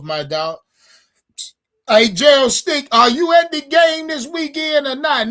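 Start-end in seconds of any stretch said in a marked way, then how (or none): 1.9-5.3 shouting a viewer's name across the room, much higher and louder; calling out. Real, not acted. Host calling out to viewer Gerald Stick, loud hail. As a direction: holler at Gerald to get an answer.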